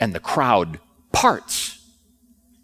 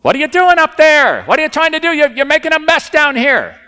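high, much louder indignant shout; angry shouting from below; 8 dB louder than usual. Retold or imitated. People downstairs shouting up at the roof, much louder and higher. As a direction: yell up at the men tearing the roof. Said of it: "They were saying".

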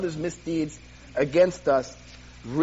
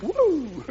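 high playful "woo"; comic rising-pitched whoop; 11 semitones higher than usual. Acted. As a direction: let out a quick high whoop. Mock reaction to being touched.

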